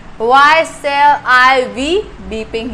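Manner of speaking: very slow, word by word; slow dictation. Shown as a demonstration. Teacher dictating to students, very slow and drawn out. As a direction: spell it out slowly for the class.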